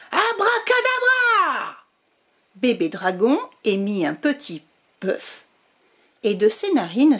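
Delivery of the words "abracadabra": high, slow shouted magic word; shouted spell. Acted. Witch casting a spell, high slow shouted abracadabra. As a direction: bellow the spell with a flourish.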